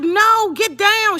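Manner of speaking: loud, high-pitched panicked command; urgent yell. Retold or imitated. Grandma to granddaughter standing amid gunfire, urgent high yell. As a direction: yell it to get her on the floor. Acted urgent order during shooting.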